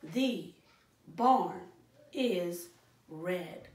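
"The barn is red" read one word at a time, each word a beat apart. Shown as a demonstration. Teacher counting words for the class, slow, one word per beat. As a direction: tap out "The barn is red" word by word, pausing between. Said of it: "Put your fist up".

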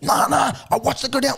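high-pitched mock protest; protesting customer. Late punter arguing with bookie staff, protesting.

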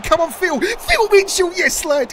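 high, loud cheering for Phil; excited cheer. Real, not acted. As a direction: roar him on. Cheering a key tackle.

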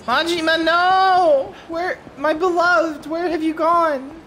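high, loud mock wail; theatrical cry of despair, played for fun; melodramatic lament. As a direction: wail slowly for a lost beloved, hammed-up. Mock lament for vanished game character.

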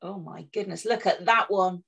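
plain reading voice

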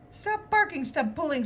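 high-pitched scolding of a dog; storybook owner's high-pitched protest; calling out. Acted. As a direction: cry out a high, urgent command to your dog. Owner scolding her runaway dog.

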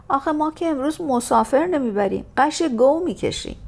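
loud, high sarcastic jab; cheeky coachman. Acted. Coachman jokes he is hauling a heavy load today, cheeky. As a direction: tease them about their weight.

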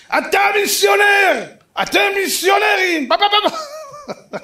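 high, slow, loud mock-furious yell; shouted accusation. Bereaved father interrupts the lecture, hammed-up accusing shouts. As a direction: bellow it, then run into loud babble.

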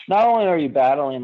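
usual speaking voice

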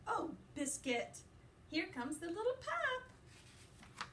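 lively, sing-song picture-book reading; reading to children. A narrator's reading. Teacher reading a puppy book to her class, playful and lively. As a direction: read it brightly to little listeners.

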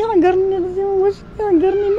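begging through crying; tearful plea. Real, not acted. Woman weeping, quoting her plea. (ترجي باكي)

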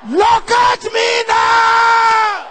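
full-force shouted sermon lines; fiery preaching. Acted. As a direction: preach it hard at the crowd. Preacher urging crowd to shout.

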